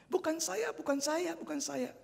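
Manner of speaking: put-on high, pleading child's voice; retelling the child. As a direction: imitate the scared boy's denial. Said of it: "Anak sekolah minggu yang baik itu berkata". (menirukan anak yang menyangkal)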